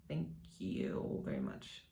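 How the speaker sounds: whispered thanks, choked up; emotional whisper. Real, not acted. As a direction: breathe the thanks softly, moved. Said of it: "before it gets emotional".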